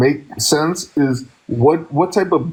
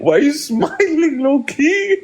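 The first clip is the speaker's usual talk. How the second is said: high giggling question, laughing hard; 12 semitones higher than usual. Real, not acted. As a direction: laugh your way through the question. Bursting out laughing at her.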